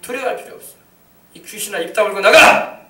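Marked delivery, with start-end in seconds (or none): 1.3-2.8 loud exorcism command; commanding rebuke. Shown as a demonstration. Preacher showing believers how to cast out a demon, commanding shout. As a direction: order the demon out, building to a loud final word. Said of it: "명령하시길 바랍니다".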